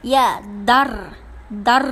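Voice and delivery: ইয়া দার with a heavy trilled ending; thick R. Shown as a demonstration. Teacher shows R after zabar, heavy ইয়া দার. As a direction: hold the rolled R of দার. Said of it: "মোটা".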